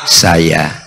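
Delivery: low, steady reply; calm low answer. Retold or imitated. The Prophet answers the sick man at the door, low and calm.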